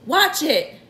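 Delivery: high, sudden warning; cried warning. A narrator's reading. Miss Spider as the room lurches, alarmed cry. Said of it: "cried Miss Spider".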